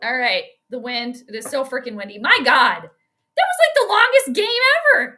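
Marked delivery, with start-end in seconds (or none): 2.2-5.2 high, loud exasperated relief; relieved outburst. Real, not acted. Fan relieved the endless game is over, loud high exasperation. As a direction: burst out that the game finally ended.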